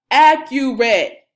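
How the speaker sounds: loud, slow, stretched out; drawn out and punched; emphatic answer. Shown as a demonstration. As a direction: hit the answer hard like a teacher. Teacher drilling key answer.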